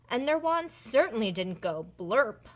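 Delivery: usual speaking voice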